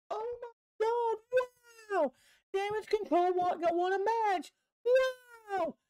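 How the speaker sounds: fake-gasp like it's a miracle; mock amazement. Recapper mocking a rare win with shrill amazement.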